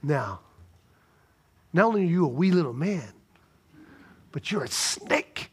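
imagined Jesus scorning Zacchaeus to his face; menacing accuser. Retold or imitated. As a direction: lean in and belittle him. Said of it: "get in his face and go".

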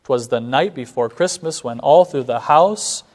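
bouncy weak-weak-strong lilt, slowing on not even a mouse; playful rhythmic reading; anapestic recitation. Shown as a demonstration. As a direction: recite with light skippy beat. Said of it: "uses anapestic feet".

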